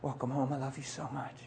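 soft, hushed loving words; tender quiet reply. Retold or imitated. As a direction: say it softly to your wife. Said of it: "I said".

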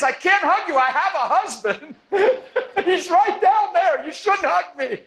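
high, laughing through the quote; laughing explanation. Retold or imitated. Preacher retells the woman's refusal, laughing high. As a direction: explain while cracking up.

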